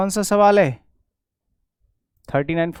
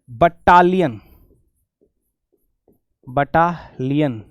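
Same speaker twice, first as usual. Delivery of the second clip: Battalion stretched very slowly; slow pronunciation; 3 semitones lower, much slower than usual. Shown as a demonstration. Teacher shows students how to read the word. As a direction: draw battalion out, बट्टा-लियन, very slowly. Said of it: "ऐसे पढ़ो".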